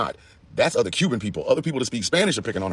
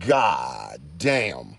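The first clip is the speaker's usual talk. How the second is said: very slow stretched god damn; long slow exasperated groan; drawn-out god damn; 8 semitones lower than usual. Real, not acted. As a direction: drag the words out in disbelief. Exasperated outburst.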